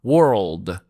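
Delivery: World said slowly, r and l clear; careful world. Shown as a demonstration. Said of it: "حالا بگو". (World شمرده و واضح)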